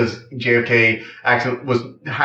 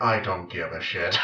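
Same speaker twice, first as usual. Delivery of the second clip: weary, low mumble; tired; 6 semitones lower, 10 dB quieter than usual. Retold or imitated. Vanya wearily brushing off Number One, tired mumble. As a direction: let it drop out with no energy. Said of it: "like, tired".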